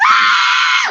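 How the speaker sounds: high, loud mock scream; baby scream. Imitating the baby screaming on seeing the nanny, mock scream. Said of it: "he went".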